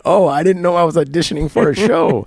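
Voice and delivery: high, loud mock-innocent sarcasm; snarky retort to a pushy detective; sarcastic quip. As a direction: throw the jab back with fake surprise. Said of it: "And I went".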